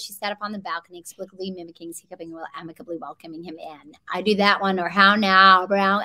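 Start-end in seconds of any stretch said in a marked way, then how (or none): 0.0-3.9 quick, soft American-accented tongue twister; exaggerated American accent. Shown as a demonstration. Actor demonstrating warm-up phrase, exaggerated quick American accent. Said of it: "if you can say it quick". 4.9-6.0 loud, exaggerated American vowels; exaggerated American accent. Shown as a demonstration. Actor showing key phrase for accent, exaggerated American. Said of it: "I exaggerate basic, like, the American sound".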